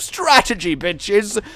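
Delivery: high, loud gloating cry; gloating cheer. Real, not acted. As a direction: crow about the clever play. Gloating over clever move.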